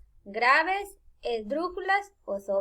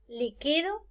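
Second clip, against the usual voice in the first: slow liquido, accent on QUI; stress on middle syllable; 3 semitones higher than usual. Shown as a demonstration. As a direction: say li-QUI-do with an unstressed ending. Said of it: "Entonces voy a decir".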